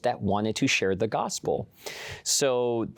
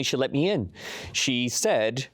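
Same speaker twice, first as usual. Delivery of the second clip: normal talking voice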